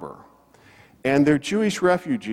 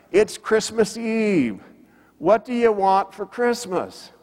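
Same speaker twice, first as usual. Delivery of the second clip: retold yell; 8 semitones higher than usual. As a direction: imitate calling across to a boat. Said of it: "he yells to them".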